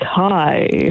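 mock on-air greeting; imitated radio voice. Wife imitating husband answering the phone, radio voice. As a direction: tease him with a radio-presenter hello. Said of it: "doing your radio voice".

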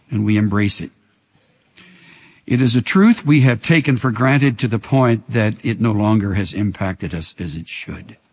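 low, hushed closing prayer. Acted.

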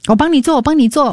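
quick, high-pitched offer of help; hammed-up keenness to help; over-eager helper. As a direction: rush in high and fast, mock-eager.

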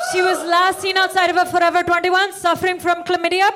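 loud, pleading charity commercial. Pretend. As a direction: plead like a rescue-animal ad. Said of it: "like an A S P C A commercial".

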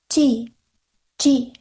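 bird cries. A narrator's reading. Two blue throats circling above the women, bird cries. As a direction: lightly imitate a small bird's alarm cry. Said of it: "two blue throats circled and screamed".

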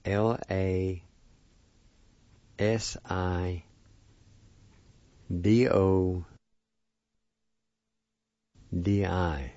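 letters in pairs with long pauses; spelled out slowly. Shown as a demonstration. Teacher spelling a word for learners, slowly letter by letter. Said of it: "It's spelled this".

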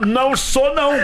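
high, sudden indignant outburst. Retold or imitated. Guest mimics the singer realising the insult, high indignant outburst. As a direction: snap into a mock-offended denial. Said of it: "Aí ele dá uma estalada".

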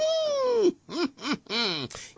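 high squealing laugh. Real, not acted. Host giggles announcing story time, high squealing laugh.

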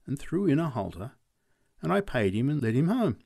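plain reading voice

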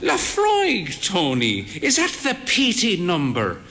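put-on the old mother's high, curious question; frail old mother. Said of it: "mother asks about the whisky".

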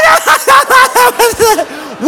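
high, loud, hammed-up cackle; breathless laughing fit. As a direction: imitate laughter that leaves no breath. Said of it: "she can't breathe".

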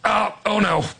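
high-pitched, halting "oh no"; acted pained alarm. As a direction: imitate someone in sudden pain, pausing between cries. Acting Ditka's hip popping out.

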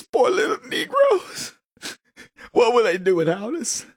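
high, far up, syrupy condescension; mock pity. Mocking condescending leftist pity.

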